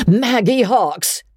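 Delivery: high, slow delighted call. A narrator's reading. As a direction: call her name across the deck. Woman calls out Magnolia's name.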